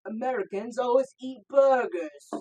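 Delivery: loud, high, slow fake English accent; mock English accent. Taking a turn at the accent challenge, loud mock English accent. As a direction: belt out an exaggerated English accent. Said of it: "Let's hear [name]".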